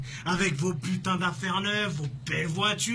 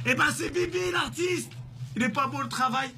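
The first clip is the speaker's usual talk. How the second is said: high, proud and laughing; gleeful boast; 6 semitones higher than usual. Acted. Killer brags to victim with a laugh. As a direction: savour the confession.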